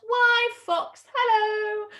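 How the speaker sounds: high, loud mouse hail; bold cheery greeting. Acted. Mouse greets fox beside Gruffalo, boldly cheery.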